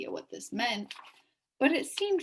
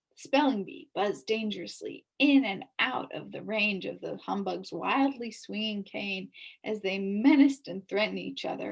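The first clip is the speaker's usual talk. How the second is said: ordinary delivery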